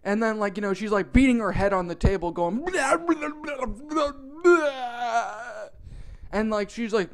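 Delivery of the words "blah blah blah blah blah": high, garbled mock wailing; imitated bawling. Imitating cashier beating her head, mock garbled bawling. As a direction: blubber nonsense syllables like exaggerated crying. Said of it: "she just keeps on bawling".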